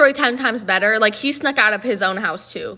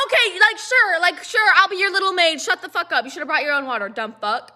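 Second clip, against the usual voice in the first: high-pitched fake compliance, souring into contempt; sarcastic; 7 semitones higher than usual. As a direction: act put-upon and sarcastic, starting high and sliding down. Mocking reaction to water request.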